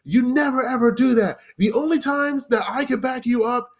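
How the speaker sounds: retold yelling. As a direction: scold the new server from up high. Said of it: "immediately starts yelling at me".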